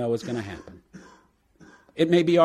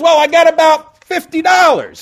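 put-on much higher, louder eager drunk; 12 dB louder than usual. Drunk offering God his cash, high and eager. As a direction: pipe up like a naive drunk ready to pay. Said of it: "And the drunk goes".

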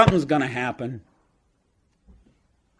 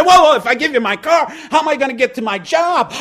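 put-on much higher, louder panicked drunk; backpedaling drunk; 12 semitones higher, 9 dB louder than usual. Drunk trying to back out of the deal, high and alarmed. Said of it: "He said".

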